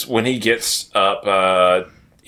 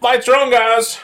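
high, raised announcement; mock calling out; 12 semitones higher, 4 dB louder than usual. Retelling a teacher addressing the hall, playful high call-out. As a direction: call out brightly to a whole room. Acting out character lighting up hall.

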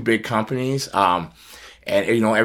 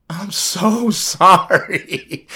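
high, slow, drawn-out apology; sorry friend; 10 semitones higher, 5 dB louder, much slower than usual. Retold or imitated. Edge apologizing over the phone, slow and high. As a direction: stretch the sorry out, pitched up.

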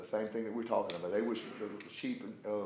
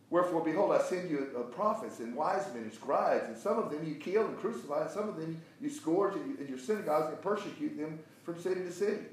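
higher-pitched preacher reading, swelling on stressed words; emphatic scripture reading; 6 semitones higher, 4 dB louder than usual. Acted. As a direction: read the rebuke out with force. Reads scripture forcefully to class.

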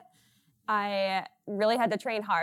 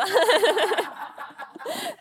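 loud laugh; 12 semitones higher, 8 dB louder than usual. Real, not acted. Laughing after worry joke.

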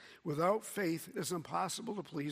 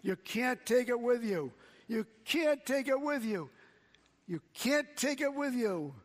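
loud, high, hammered preaching yell; excited revival-tent shout, repeated; evangelist yelling; 6 semitones higher, 5 dB louder than usual. Retold or imitated. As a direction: shout it at the crowd like a fired-up evangelist. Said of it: "he started yelling".

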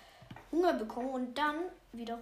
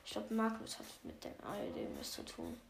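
ordinary talk

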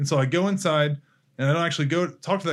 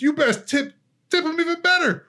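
much higher, louder preacher-like urging; rally the listeners with fervor; excited exhortation; 13 semitones higher, 4 dB louder than usual. Real, not acted. Fervent exhortation about tipping.

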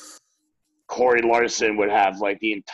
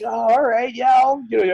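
much higher, louder eager yes; eager agreement; 13 semitones higher, 6 dB louder than usual. Retold or imitated. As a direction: jump at the offer happily. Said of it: "and you're like".

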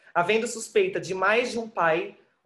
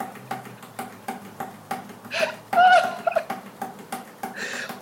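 hysterical shriek. Acted. Climax of frenzy.

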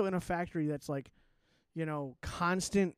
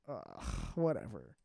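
low, slow imitated brush-off; softer, lower retold huff of dismissal; mock dismissive grumble; 10 semitones lower, 5 dB quieter, slower than usual. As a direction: groan it like a caught-out mom. Mom brushing off being caught out.

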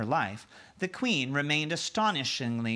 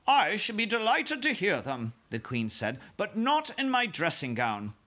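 queen voice; 7 semitones higher than usual. Acted.

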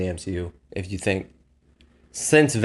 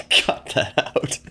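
laughing; much slower than usual. Real, not acted. Laughing plea to edit.

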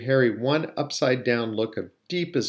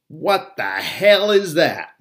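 loud, high, slow outraged bellow; 6 semitones higher, 7 dB louder, slower than usual. A narrator's reading. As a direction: bellow it, drawing out each word. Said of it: "Uncle Vernon bellowed".

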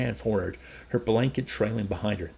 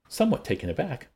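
usual speaking voice

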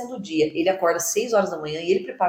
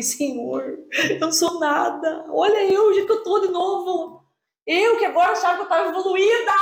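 tearful prayer; 7 semitones higher than usual. Retold or imitated. Crying out to God in prayer.

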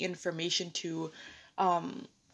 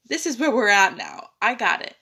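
louder, taken-aback acceptance; imitated stunned reaction; 3 semitones higher, 9 dB louder than usual. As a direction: act out jaw-dropped disbelief, then getting it. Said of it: "mouth dropped open, like".

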